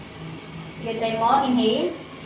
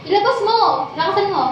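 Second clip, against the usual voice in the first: high, insistent coaching cry; urging coach; 7 semitones higher than usual. Real, not acted. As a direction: push the student to let it out. Coach urging student to shout louder. (mataas ang tono, nag-uudyok)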